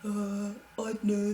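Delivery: slow, lightly put-on dim voice; a hint of a slow, thick cartoon bully; lightly voiced slow dopey cartoon boy; dopey cartoon kid voice. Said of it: "The one that's always like".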